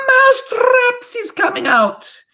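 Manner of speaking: very high, slow witch shriek; full-out accented witch shout; Grand High Witch's shout. A narrator's reading. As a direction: bellow it to the coven, drawn out. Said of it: "raised her voice louder than ever".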